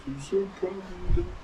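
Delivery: put-on high, childlike voice for his son's words; voicing the son at the finish line with a high, childlike lift; imitating his proud son. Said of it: "I actually got all emotional".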